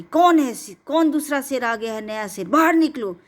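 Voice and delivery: high, loud roaring challenge into the cave; roaring lion challenge. Acted. Lion roaring at the rival in the cave, loud and high. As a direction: bellow it out like a lion calling out a rival. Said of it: "तो दहाड़ मार करके".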